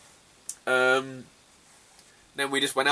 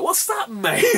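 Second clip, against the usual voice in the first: much higher puzzled voice; mock confusion; slower than usual. Asking the stranger offering drugs, in a high baffled voice. Retold reply to drug dealer.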